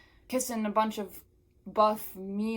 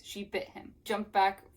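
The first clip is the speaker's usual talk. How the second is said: ordinary talk, nothing marked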